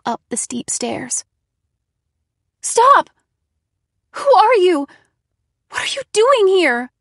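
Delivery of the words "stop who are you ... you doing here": high, loud frightened screams; screaming. A narrator's reading. Narrator screams at the intruder while climbing, terrified. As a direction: scream up the stairs in terror. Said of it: "I screamed all the way up".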